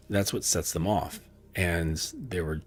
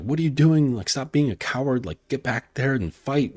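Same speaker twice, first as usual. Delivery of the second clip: scolding; 6 semitones higher than usual. Acted. Knight scolds his fleeing horse.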